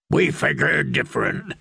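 rough mocking killer with a laugh; the Hound taunting the prisoner he means to hang; menacing hound. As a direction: sneer it down at your captive. The hound speaks again.

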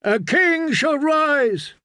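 high, drawn-out rallying cry; crowd cry. A narrator's reading. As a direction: cry it out as a chanting follower. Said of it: "the drowned men cried".

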